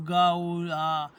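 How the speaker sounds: loud drawn-out gull wail; begging gull cry. Shown as a demonstration. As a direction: cry out like a hungry young gull. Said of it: "اس طرح آواز نکالی".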